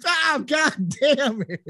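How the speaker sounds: high, drawn-out comic yell; hammed-up agonized cursing; pained outburst. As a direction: cry out as if suddenly whacked. Acts out sudden hit, yelling.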